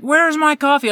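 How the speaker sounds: very high mock demand; mock yell. Customer yelling at manager for coffee, high mock yell. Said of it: "yelling at me".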